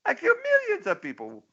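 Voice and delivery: high, squeaky mock dictator protesting; cartoon Pol Pot voice. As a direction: squeal it like an offended cartoon tyrant. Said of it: "He says".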